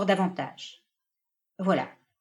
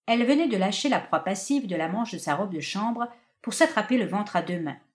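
ordinary delivery

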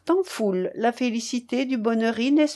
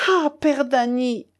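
higher, slow drawn-out lament; anguished reproach; 4 semitones higher than usual. A narrator's reading. Mother fearing her son dead, anguished reproach. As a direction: reproach him in grief, pitched up.

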